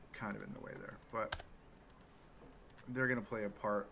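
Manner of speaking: usual speaking voice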